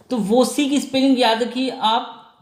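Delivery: normal talking voice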